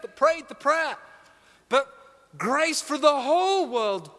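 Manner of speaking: sermon delivery, soft then building; preaching. Acted. Preacher urging the congregation to take the good news out. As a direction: preach it with rising conviction.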